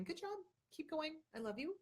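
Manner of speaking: a kind little voice cheering you on; gentle inner voice. Retold or imitated. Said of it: "that tiny little voice going".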